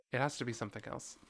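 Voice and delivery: ordinary delivery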